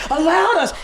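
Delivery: high, loud, indignant outburst; imitated outraged scream. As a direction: mimic a drunk friend blowing up. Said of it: "Sean just went from screaming".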